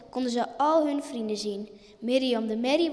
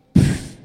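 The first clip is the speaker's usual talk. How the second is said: loud puff of scorn; dismissive pff; 12 dB louder than usual. A narrator's reading. Camel dismisses what the donkey said, loud scoffing puff. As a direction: blow it off with a puff.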